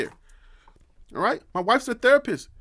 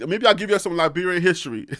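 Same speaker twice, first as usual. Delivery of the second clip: normal talking voice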